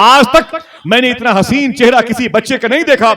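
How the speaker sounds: high, loud impassioned cry; preaching at full force. Acted. Grandfather marvelling at the baby's face, shouted high. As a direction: throw the line out to the whole crowd.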